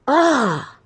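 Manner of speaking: a short high cry of exasperation; frustrated cry. Real, not acted. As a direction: let out a fed-up wail. Frustrated with the company.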